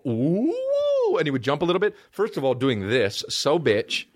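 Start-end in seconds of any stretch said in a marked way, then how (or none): 0.0-1.2 very high gliding "woo"; imitated siren swoop to the top of the voice; mocking chorus teacher's high hoot; teacher's warm-up whoop. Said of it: "He would go".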